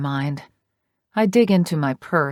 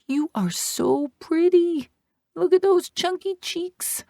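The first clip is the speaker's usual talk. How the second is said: put-on sing-song baby voice to a newborn; gushing baby talk; high baby talk. A narrator's reading. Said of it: "I coo at her".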